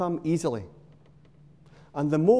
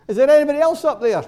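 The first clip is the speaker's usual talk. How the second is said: much higher and louder, fast, panicked; desperate shout upward; 9 semitones higher, 10 dB louder, much faster than usual. Acted. Man on the branch yells to heaven for someone else, panicked. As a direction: blurt it out in a rush, desperate. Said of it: "calls out".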